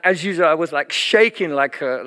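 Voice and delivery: ordinary talk, nothing marked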